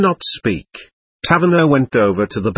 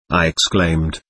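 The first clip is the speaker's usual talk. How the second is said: ordinary delivery, nothing marked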